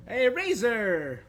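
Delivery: ordinary talk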